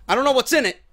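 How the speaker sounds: high, loud burst of excitement; excited. Real, not acted. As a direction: hype up the mystery box. Opening a mystery crate.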